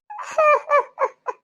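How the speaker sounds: high mocking cackle; gloating laugh. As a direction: cackle with glee at their misfortune. Villain gloats after the drama. (ಕುಹಕದ ನಗು)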